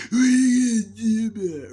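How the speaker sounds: high, loud squeaky challenge; shouted mantis taunt. Pretend. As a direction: yell it like a tiny bug picking a fight. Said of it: "another praying mantis noise".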